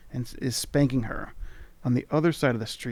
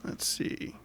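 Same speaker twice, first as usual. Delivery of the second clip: soft unvoiced musing; muttering to self; 5 semitones higher, 11 dB quieter, slower than usual. Real, not acted. Player quietly checking the spell list. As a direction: think aloud under the breath. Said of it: "player musing quietly to self".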